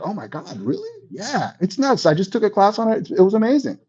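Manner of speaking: high gushing amazement; amazed friend. Retold or imitated. Friend gushing about the tax news, high and amazed. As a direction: gush like you just learned it. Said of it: "they're gonna be like".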